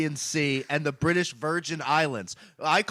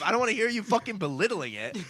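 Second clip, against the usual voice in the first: ordinary talk, nothing marked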